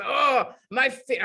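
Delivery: slow, badly faked Russian accent. Imitating big runner with sore feet, Russian accent. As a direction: moan it with a rough stab at Russian. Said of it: "really heavy Russian accent".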